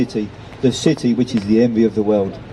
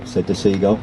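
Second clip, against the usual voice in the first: usual speaking voice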